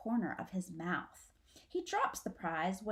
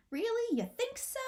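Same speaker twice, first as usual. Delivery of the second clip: put-on hopeful child; 14 semitones higher than usual. Young possum cheered up.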